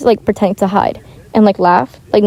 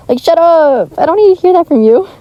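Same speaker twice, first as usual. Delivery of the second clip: higher, louder snapping outburst; annoyed outburst; 7 semitones higher, 4 dB louder than usual. Retold or imitated. Fed up with kids giving makeup tips, a loud high outburst. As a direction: bite back hard, loud and high. Ranting at girls pushing makeup.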